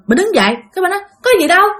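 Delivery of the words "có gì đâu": higher, hurried mock-startled denial; rapid-fire startled wife. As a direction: act out brushing it off fast, caught off guard. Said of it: "bà vợ bà giật mình".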